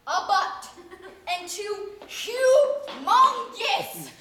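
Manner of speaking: slow, loud, laughing stretch of the words; laughing exclaim. Acted. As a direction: drag it out through laughter. Comic climax of dream.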